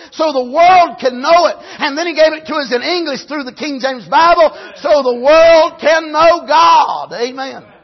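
preaching climax. Acted. Preacher praising the King James Bible, rising shout. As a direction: hit the peak and hold.

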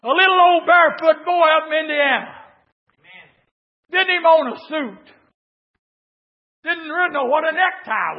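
loud, high, fervent preacher's cry; full force preaching. Acted. Preacher tells the congregation of his poor boyhood, loud and fervent. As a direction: preach it out to the whole church.

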